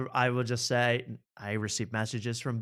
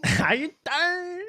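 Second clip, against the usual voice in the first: very slow, high New York greeting; hammed-up New York accent; much slower than usual. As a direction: stretch the New York catchphrase. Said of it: "a whole nother accent he puts on".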